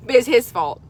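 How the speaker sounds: ordinary delivery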